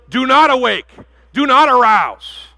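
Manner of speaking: high, loud, with do not arouse drawn out slowly; solemn command not to awaken love; stern warning. Acted. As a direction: lean hard on NOT each time. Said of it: "she been told not to do".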